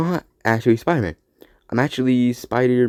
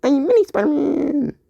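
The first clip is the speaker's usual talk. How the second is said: put-on much higher squeaky kid voice; Mini Spider-Man. Mini Spider-Man announcing himself to a friend, squeaky high. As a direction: squeak it out proudly. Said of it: "Do you talk like that because of it?".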